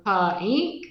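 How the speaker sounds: "puh ink" for pink, split after an uh; "puh ink" as two pieces, puh then ink; added schwa sound. A narrator's reading. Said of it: "He added his extra uh sound".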